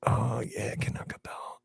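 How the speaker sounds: whispering. Acted. Whispered reply.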